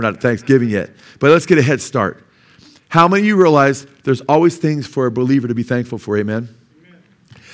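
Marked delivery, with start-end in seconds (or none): none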